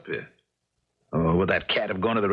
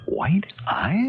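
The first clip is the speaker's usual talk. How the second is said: uneasy whispered question; 9 semitones higher than usual. Acted. Man's uneasy private thoughts.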